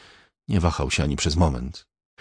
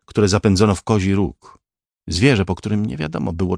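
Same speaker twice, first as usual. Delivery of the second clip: ordinary delivery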